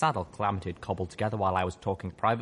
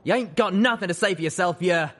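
angry father; 11 semitones higher, 5 dB louder than usual. Acted. Father yelling.

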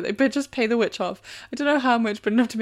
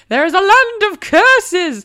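high, loud, slow shout; furious shout; 10 semitones higher, 14 dB louder, much slower than usual. Retold or imitated. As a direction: bellow the curse. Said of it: "Drops voice to a growl".

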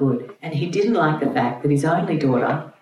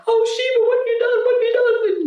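wailing wife; faster than usual. Retold or imitated. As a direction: shriek it up high like a horrified wife. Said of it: "his wife was going".

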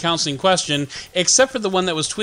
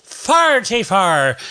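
hammed-up Irish brogue, pitched up; bad Irish accent; 4 semitones higher, 3 dB louder than usual. Host welcoming podcast listeners before dropping it, bad Irish accent. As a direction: greet listeners in a cheerfully fake Irish accent. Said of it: "drop the Irish accent".

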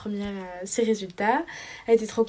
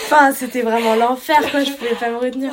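laughing; 2 semitones higher, 10 dB louder than usual. Real, not acted. Laugh heard on line.

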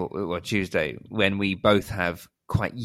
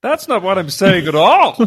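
high, loud put-on voice; mock indignant protest; 11 semitones higher, 11 dB louder than usual. Podcaster parodying an arguer for laughs, mock indignant protest. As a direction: protest shrilly in a hammed-up high voice.

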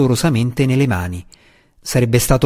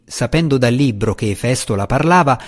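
ordinary delivery, nothing marked

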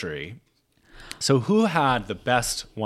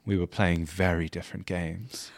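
acting Lady Sarah; 4 semitones lower, 4 dB quieter than usual. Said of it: "I'll be Lady Sarah".

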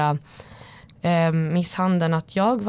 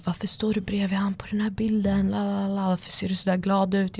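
softer, slightly raised mock interrogation; imitated jealous nagging; 2 semitones higher, 3 dB quieter than usual. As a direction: mimic a jealous partner going on and on. (härmat svartsjukt tjat)

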